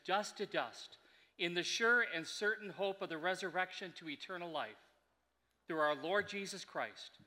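solemn liturgical committal reading; hushed graveside prayer. Acted.

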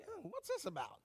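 put-on high, soft, puzzled girl's voice; mock puzzled Mary. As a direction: ask it like a bewildered teenage girl, softly. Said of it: "She's like".

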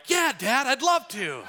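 high-pitched, over-keen yes to Dad; mock eager son. Dad imagines his sons' dream reply, mock eager. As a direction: gush it like an impossibly willing kid. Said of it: "they're not like".

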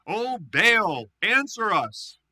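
loud, high pleading cry to Baal; shouting. Acted. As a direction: call out desperately to a silent god.

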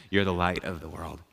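low, soft, unruffled reply; calm reassurance. Retold or imitated. Jesus answering the ranting pastor, calm reassurance. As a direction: drop down low and quiet, unmoved by the protest. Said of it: "Jesus said".

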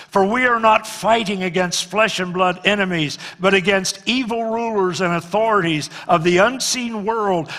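loud, high solemn Bible reading; scripture proclaimed. Acted. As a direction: proclaim the passage to the congregation.

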